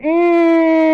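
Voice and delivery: long, loud, high squeal; acted high squeal. Comic mimes feeding cake to kids, high drawn-out squeal. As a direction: hold out an exaggerated high noise.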